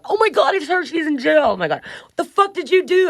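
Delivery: put-on high, shocked girl's voice; shocked inmate. Cellmate hearing she's in jail, high shocked squeal. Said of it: "they go".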